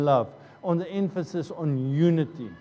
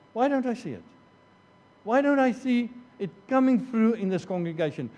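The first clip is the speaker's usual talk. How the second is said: raised higher, asked twice; a higher, insistent why; pleading question; 6 semitones higher, faster than usual. Acted. Preacher repeats the question, pleading.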